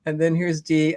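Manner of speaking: ordinary talk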